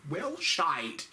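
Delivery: deadpan British curse; British accent. Retold or imitated. Comic voicing Theresa May's disappointed British curse. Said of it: "Theresa said".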